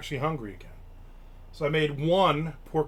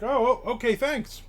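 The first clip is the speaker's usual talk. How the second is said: high, startled little thank-you; mock surprise at free pizza; caught off guard; 9 semitones higher than usual. To the waiter bringing unordered pizza, surprised. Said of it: "I'm like".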